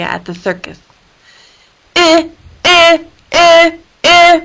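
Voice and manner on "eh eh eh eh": loud high mechanical beeps; beeping. A narrator's reading. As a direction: beep at her like an alarm. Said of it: "Irene beeped at her".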